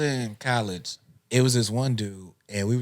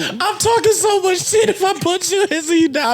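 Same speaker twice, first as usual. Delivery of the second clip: high mock voice; 3 dB louder than usual. Joking high voice.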